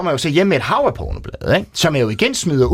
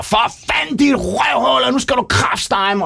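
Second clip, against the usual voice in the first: retold furious yell; 12 semitones higher than usual. Kiosk owner yelling at the thief prying open his machine, furious shout. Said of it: "og råber".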